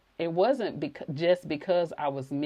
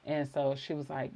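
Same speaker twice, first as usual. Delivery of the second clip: ordinary talk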